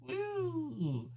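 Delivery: excited whoop. Acted. Fish girl whoops while showing off her trident, delighted. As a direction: cheer at your own cool trident throw.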